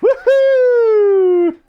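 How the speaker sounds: very high, loud joyful whoop; excited cheer. Pretend. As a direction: cheer like you just won.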